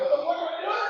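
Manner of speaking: sudden panicked outburst; panicked yell. Retold or imitated. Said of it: "I was like".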